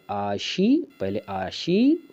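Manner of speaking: आशी held long and high; syllable breakdown. Shown as a demonstration. Teacher showing what comes first, आशी high and drawn out. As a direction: lift and stretch आशी before any r. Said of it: "उसके बाद ये रा".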